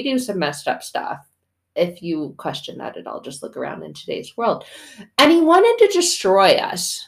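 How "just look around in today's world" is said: ordinary talk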